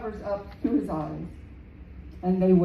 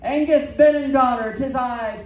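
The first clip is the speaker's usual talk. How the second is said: loud, higher shout of a challenge; 4 semitones higher, 7 dB louder than usual. Acted. Finn shouts his challenge after crossing the causeway. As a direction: bellow the name to call him out. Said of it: "and he shouted".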